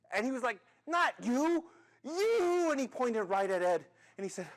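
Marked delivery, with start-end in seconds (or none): 0.9-2.7 high, loud manager's shout; exasperated high-pitched holler; angry yell. Acted. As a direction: yell it, hitting the last word higher. Screaming manager corrects the accused.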